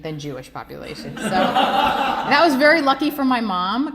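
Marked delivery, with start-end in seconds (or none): 1.2-3.9 laughing. Real, not acted. Laughing aside about mom's luck.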